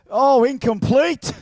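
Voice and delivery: high, loud, slow sportscaster groan; playful commentator calling it incomplete; mock referee call. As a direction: announce the miss like a commentator. Mock referee call after miss.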